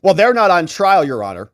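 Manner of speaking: a loud mocking prosecutor retort. Host mocks the prosecutor's stock reply, loud and smug. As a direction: snap it back like a smug prosecutor. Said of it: "prosecutor responds, just like every government prosecutor does".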